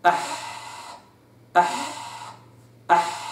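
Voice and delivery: أح with a soft, voiceless ح; silent ha. Shown as a demonstration. Teacher drilling the silent ح, breathy أح. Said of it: "الساكنة هكذا".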